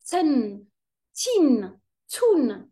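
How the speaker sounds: "ten, tin, tun" slow, each T doubled and hit hard; teacher drills students on shadda T sounds, slowly; stressed doubled T. Shown as a demonstration. تشديد التاء ببطء. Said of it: "j'accentue plus la lettre T".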